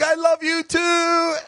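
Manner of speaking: high, slow mock reply called back; high drawn-out loving reply; calling back. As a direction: answer her call across the hall. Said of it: "And I'm like".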